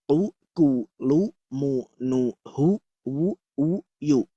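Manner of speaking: U, bu, tu with lips pushed into a pout; pouted dhammah drill. Shown as a demonstration. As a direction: chant each u syllable with rounded lips. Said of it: "perhatikan mulut saya".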